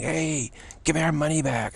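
high-pitched mock heckle; retold yelling. Audience member demanding money back from singer, retold yelling. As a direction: call out like a heckler, without full volume. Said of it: "People are yelling".

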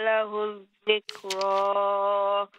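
melodic tajweed chant; Quran recitation. Acted. Caller recites surah al-Fajr.

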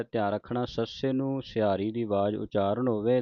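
normal talking voice

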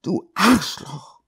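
slow, tearful insult; a touch of weeping in Du Arschloch; crying. Acted. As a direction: spit it out through tears. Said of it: "sagte sie weinend".

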